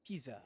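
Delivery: "Pizza" with no T, like Pisa; soft S or Z in "Pizza", PEE-zuh; accented pronunciation. Retold or imitated. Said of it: "I've heard that too".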